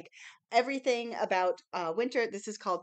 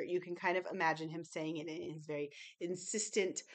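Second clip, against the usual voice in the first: normal talking voice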